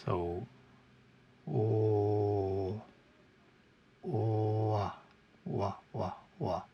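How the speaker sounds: slow drilled wah syllables; W sound demo. Shown as a demonstration. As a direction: model the W glide, lips rounded. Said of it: "glides down a little bit".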